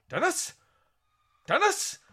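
high, loud Yorkshire call into the house. Retold or imitated. Man calling for Dennis and Jenny, high Yorkshire call. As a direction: shout the names in a put-on Yorkshire accent. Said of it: "and he's like".

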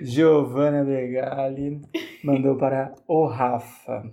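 slow, low sultry read; sensual voice. Acted. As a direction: purr it out slowly and seductively. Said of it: "Vou ler com uma voz sensual aqui".

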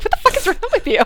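high, exasperated what the fuck; a friend baffled by her shaking; incredulous friend. Retold or imitated. Friend scolds her shivering.

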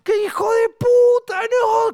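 very high, loud falsetto cheering; cracking up in falsetto at the joke; the joke club roaring with delight, very high; mock delighted crowd. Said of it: "Y todos".

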